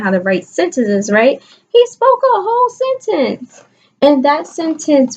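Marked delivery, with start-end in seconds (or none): 1.7-3.6 much higher, wide-eyed read-aloud; excited storytelling. A narrator's reading. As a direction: build the suspense for the class. Teacher builds suspense reading story.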